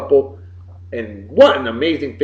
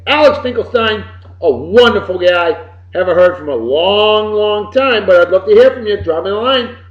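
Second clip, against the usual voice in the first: normal talking voice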